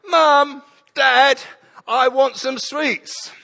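put-on high whining child asking for sweets; child's whine. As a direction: ask like a begging little kid. Child voiced in story.

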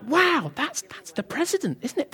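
soft, high-pitched retold wonder; hushed awe. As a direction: drop to an awed, quiet voice. Retold awe at seeing the president.

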